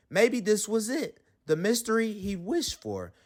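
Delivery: plain reading voice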